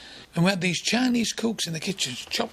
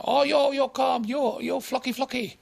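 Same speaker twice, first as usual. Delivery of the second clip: mocking madam; 8 semitones higher than usual.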